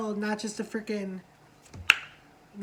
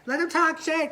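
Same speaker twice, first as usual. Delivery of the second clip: high, loud egging-on shout; mock hype shout; 7 semitones higher, 6 dB louder than usual. [name] egging the fight on, high and loud. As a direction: cheer the shade on, pitched up and loud.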